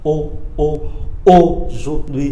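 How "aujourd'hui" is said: aujourd'hui said slowly, stressing the ô at its start; slow careful aujourd'hui, leaning on the ô; slow model pronunciation. Shown as a demonstration. Teacher repeats aujourd'hui slowly, stressing the ô sound. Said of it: "chúng ta cứ nhớ cái này là ô".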